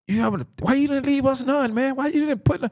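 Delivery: high-pitched protest; imitated high-voiced grievance; retold incredulous complaint. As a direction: act out a son pleading his case to dad, voice way up.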